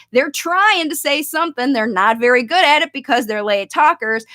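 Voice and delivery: usual speaking voice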